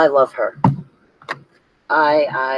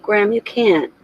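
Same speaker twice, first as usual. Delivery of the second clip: raised, higher-pitched plea of disbelief; retold pleading protest; 7 semitones higher than usual. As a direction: lightly replay telling grandma she can't mean it. Arguing with grandma over racism.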